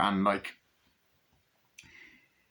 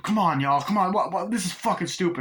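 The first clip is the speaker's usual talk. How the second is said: high, exasperated pleading; acted protest; 7 semitones higher, 4 dB louder than usual. Crewman objecting to the blood test, acted exasperation. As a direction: protest as if nobody will listen.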